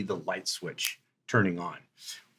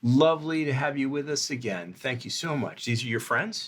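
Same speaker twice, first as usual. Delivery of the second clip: high, loud, gushing welcome; effusive restaurant host; 6 semitones higher, 5 dB louder than usual. Acted. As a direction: fawn over a favourite regular guest. Game master greets as restaurant host.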